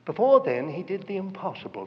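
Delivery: usual speaking voice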